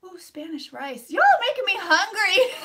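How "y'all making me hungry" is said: laughing. Real, not acted. Laughing at food comments.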